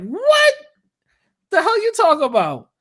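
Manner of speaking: high, shocked outburst on what; incredulous. Retold or imitated. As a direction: cry out in disbelief. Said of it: "And I'm like".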